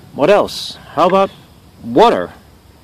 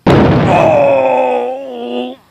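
high, long wailed "oh"; pained wail; 13 semitones higher, 4 dB louder than usual. Acted. Host struck by producer's rock, high pained wail. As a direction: wail out in sudden pain.

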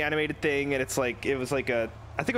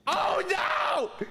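panicked cry; 14 semitones higher, much slower than usual. Real, not acted. Lock pick about to break.

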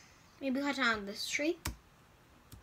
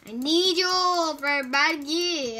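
slow, louder mock voice for the animals; silly put-on voice; 3 semitones higher, 7 dB louder, slower than usual. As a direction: talk to the animal in a goofy slow voice. Talking to Minecraft animals in a silly voice.

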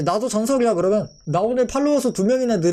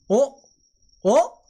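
surprised; 4 semitones higher, slower than usual. Real, not acted. Shocked at the evolution.

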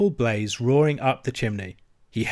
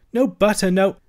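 higher, louder woeful listing; dolorous; 8 semitones higher, 5 dB louder than usual. Acted. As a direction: mourn each missing thing. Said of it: "groaned the Mole dolorously".

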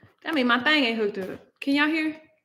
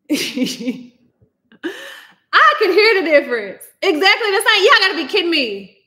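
loud, higher disbelief; exasperated; 7 semitones higher, 6 dB louder than usual. Real, not acted. Streamer protesting to chat that she hears the difference, exasperated. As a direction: protest it with a laugh in your throat.

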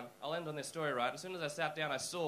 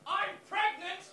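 high, loud mock outburst; pregnant lady cracking it; 13 semitones higher, 5 dB louder, slower than usual. Pregnant lady answering old guy, hammed-up high outburst. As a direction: shriek it as an indignant comeback. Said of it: "the pregnant lady cracked it".

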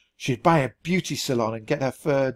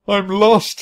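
higher, louder choked cry; choked sob; 7 semitones higher, 8 dB louder than usual. A narrator's reading. Ranger sobbing to the mouse that he's lost. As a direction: choke it out through tears. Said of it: "he choked".

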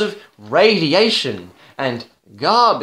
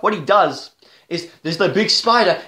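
normal talking voice

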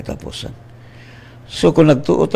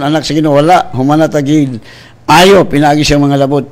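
ordinary talk

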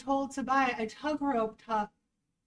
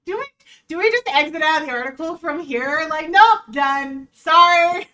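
exasperated outburst; 5 semitones higher, 13 dB louder than usual. Real, not acted. Exasperated, laughing at article.

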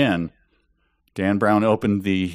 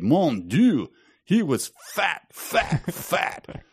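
higher and higher mock French fat fat fat; French Canadian accent; 9 semitones higher, much slower than usual. Reader mocking the German in a French Canadian accent. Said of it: "maybe my Canadian accent".

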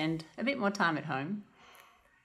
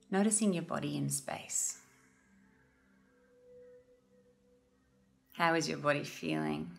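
calm, unhurried meditation guide with long pauses; guided meditation. Real, not acted. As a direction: gently guide listeners into noticing their breath.

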